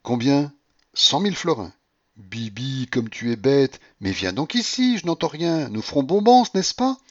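flirtatious, rising to squeals; playful coquette. Acted. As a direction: coax him over, giggly and bold. Blanche voiced by reader.

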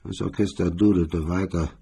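ordinary talk

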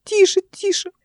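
very high, urgent shushing; piping boyish hush; squeaky boy. A narrator's reading. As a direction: shush anxiously in a small child's voice. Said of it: "писклявый голос мальчика".

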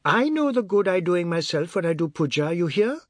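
insist, affronted; high, aggrieved telling-off; indignant boy. A narrator's reading. Boy rebukes the man, aggrieved.

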